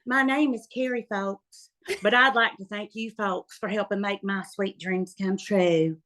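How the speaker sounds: lightly exaggerated Southern pageant accent; mock Southern drawl. Retelling her pageant speech in a light mock Southern accent. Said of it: "And I was so Southern".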